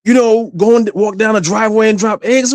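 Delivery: mock mother cross-examining son; accusing mother. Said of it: "she was like".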